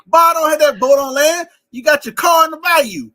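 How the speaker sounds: high, loud mock bayou Cajun accent. Comedian shows what people think New Orleans sounds like, exaggerated Cajun accent. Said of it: "people think New Orleans just talk like this".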